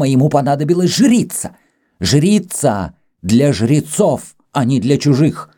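high, heated, scornful tirade; jealous rant. A narrator's reading. Greek rants at Kama about her rivals, furious.

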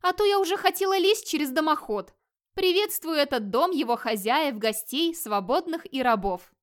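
put-on lively young woman's greeting, starting high; cheerful. A narrator's reading. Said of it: "весело произнёс из тьмы молодой женский голос".

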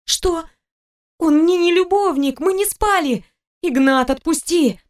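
high-pitched, urgent female character voice; desperate protest. A narrator's reading. Wife begging her husband to let the man go, desperate protest. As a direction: plead high, drawing out the last words. Wife screaming to stop strangling.

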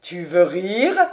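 a hint of the master, incredulous; disbelieving master. Master doubts housekeeper's flattery.

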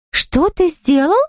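high, slow startled cry. Acted. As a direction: jump at the news. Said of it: "Так и подскочила мисс Флинт".

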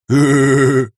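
loud horse-like guffaw. A narrator's reading. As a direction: burst out laughing loudly. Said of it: "заржал Вася".

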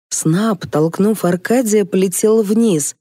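ordinary delivery, nothing marked

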